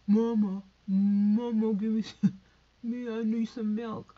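put-on loud whiny baby calling for milk; loud pretend baby begging for milk; baby wanting milk. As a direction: wail like a hungry baby.

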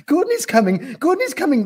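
very high, loud babble; excited babbling. A narrator's reading. Yak tells the gnu, babbling excitedly. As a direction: babble the news in a frenzy. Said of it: "babbled the yak".

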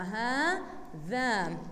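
هذا drawn out very slowly, each syllable apart; teacher stretches هذا syllable by syllable; split into syllables. Shown as a demonstration. Teacher showing the class how هذا is cut into syllables, said very slowly. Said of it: "هيك أنا بقطعها".

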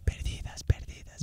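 susurrado, como un eco que se apaga; susurro de eco. Retold or imitated. Eco del video imitado. (susurro de eco imitando el video)

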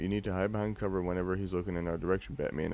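ordinary talk, nothing marked

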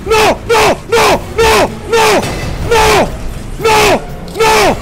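loud repeated high cries of no; panicked screaming. Real, not acted. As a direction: scream no over and over. Other player screaming in panic.

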